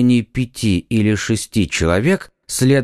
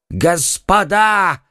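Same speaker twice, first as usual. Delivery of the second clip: high drawn-out wail; wailing cry; 9 semitones higher, much slower than usual. Acted. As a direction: wail it out in panic. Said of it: "взвопил он". (протяжный вопль)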